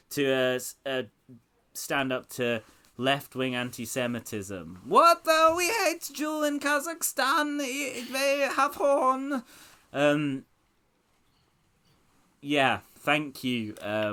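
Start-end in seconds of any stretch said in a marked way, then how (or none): 4.8-9.4 high hammed-up Borat impression; squeaky Kazakh reporter voice, slow at the end. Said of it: "Mister Borat".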